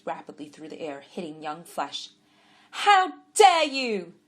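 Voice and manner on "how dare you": high, loud, slow outraged cry; furious yell. A narrator's reading. Woman protecting a blind man, furious yell. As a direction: roar it at the cruel children. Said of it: "yelled furiously".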